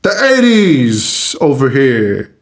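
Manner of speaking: slow, syrupy courtroom drawl; honeyed southern lawyer drawl. Shown as a demonstration. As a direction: sweet-talk the jury like a Southern lawyer. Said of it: "I would like to do it in this voice".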